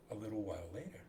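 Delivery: ordinary talk, nothing marked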